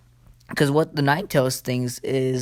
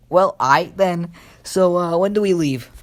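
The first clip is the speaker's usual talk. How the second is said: higher, louder, playfully keen acting; mock eager agreement; 5 semitones higher, 5 dB louder than usual. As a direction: jump at the offer, lightly hammed up. Acts Naruto eagerly accepting the bribe.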